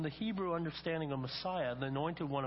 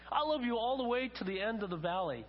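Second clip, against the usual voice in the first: put-on higher-pitched excited child in a story; eager little rabbit; 6 semitones higher than usual. Little rabbit outdoing father in story.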